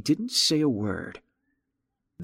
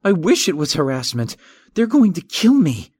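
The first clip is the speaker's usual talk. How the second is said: panicky fear; 7 semitones higher, 6 dB louder than usual. Acted. Terrified man fears murder.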